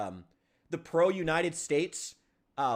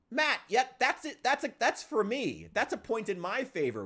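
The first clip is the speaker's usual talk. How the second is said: excited; 8 semitones higher than usual. Real, not acted. Gleefully claims point in debate.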